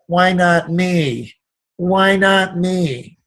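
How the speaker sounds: slow, whiny self-pitying why not me; victim voice. Shown as a demonstration. A speaker demonstrating the victim voice, slow and whining. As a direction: moan it like a victim, dragging each word. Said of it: "the victim voice of".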